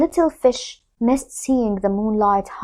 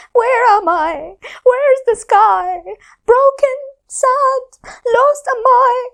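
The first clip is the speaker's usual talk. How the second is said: high, sad little voice of the moon; lonely song; 13 semitones higher, 4 dB louder than usual. Acted. The broken moon lamenting alone, high and sad. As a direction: sound lost and broken. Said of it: "her lonely song".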